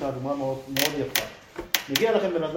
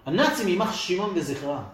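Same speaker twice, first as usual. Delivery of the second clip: ordinary delivery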